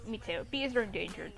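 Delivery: usual speaking voice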